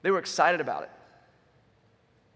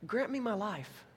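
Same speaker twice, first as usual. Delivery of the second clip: higher, hushed plea to a king; quiet, humble begging; soft plea; 5 semitones higher, 7 dB quieter, slower than usual. Acted. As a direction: plead softly, pitch lifted. Acts Esther pleading with the king.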